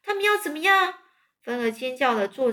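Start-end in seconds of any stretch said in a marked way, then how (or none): 0.0-1.0 high, startled squeal; a piglet shrieking in panic; frightened scream. A narrator's reading. Wilbur shrieks at the sheep, high and frightened. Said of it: "韦伯就尖叫说".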